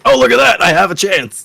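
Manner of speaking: high, loud mock delight; mock excitement. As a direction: ham up a lucky gasp. Imitating a player stalling.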